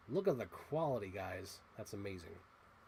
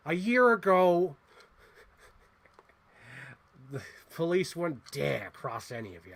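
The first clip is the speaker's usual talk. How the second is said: Joker; 5 dB louder than usual. Retold or imitated. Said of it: "I feel like Joker".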